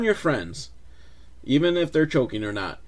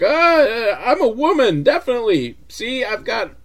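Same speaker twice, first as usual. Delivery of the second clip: a bad disguise trying to sound female; man faking a woman; 8 semitones higher than usual. Tom Arnold in a wig fooling the thugs, hammed-up high voice. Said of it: "he's like".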